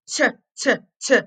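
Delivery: "T" as a short tuh, not tee; T sound. Shown as a demonstration. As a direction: separate tuh bursts for each "T". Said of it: "what's the T sound".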